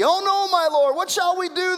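panicked high plea, oh no my lord; frightened cry. Retold or imitated. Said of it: "the servant asked".

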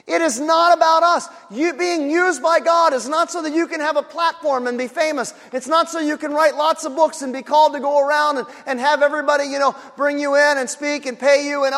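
loud, high impassioned preaching; fiery preaching. Acted. Pastor to congregation, fervent loud preaching.

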